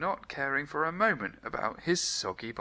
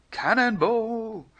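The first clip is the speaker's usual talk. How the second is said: high, loud, slow gleeful yell; leaping into the postbag, gleeful yell; cannonball shout; 12 semitones higher, 6 dB louder, slower than usual. A narrator's reading. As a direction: shout it as you leap. Jumping into the postbag.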